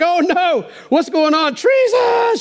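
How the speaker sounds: high shrill-free panicked shout rising to a yelp on Treason; hammed-up queen shrieking in alarm; mock yell. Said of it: "She yells treason".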